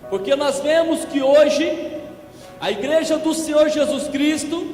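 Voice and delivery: normal talking voice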